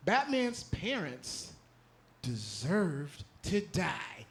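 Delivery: very slow, sinking ominous delivery; dramatic slow reveal. Pretend. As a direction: drag out each word like a verdict. Dramatic reveal of hot take.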